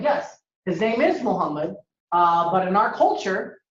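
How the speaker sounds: ordinary talk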